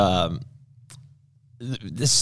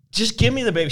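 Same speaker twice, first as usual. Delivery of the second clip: higher, exasperated mock demand; pissed-off mother; 9 semitones higher, faster than usual. Wife demanding her baby from husband, pissed off. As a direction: imitate a mother losing patience, pitch raised. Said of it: "She's getting really pissed off".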